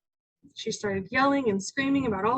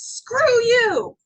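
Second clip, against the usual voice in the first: loud, high mock teen yell; angry teen outburst; 10 semitones higher, 9 dB louder than usual. Teen daughter lashing out at parents, loud angry yell. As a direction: lash out as a furious teen.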